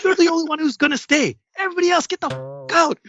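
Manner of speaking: high mock-angry command; mother letting the sober guest stay, ordering the rest out; imitated angry mom. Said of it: "She goes".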